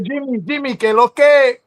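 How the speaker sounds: high, loud Dominican greeting; Dominican accent. Retold or imitated. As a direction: call him out Dominican style.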